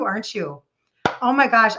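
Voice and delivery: ordinary talk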